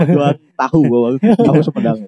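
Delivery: high, loud teasing; loud joking. Real, not acted. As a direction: shout the joke out. Bercanda soal jualan. (berteriak sambil bercanda)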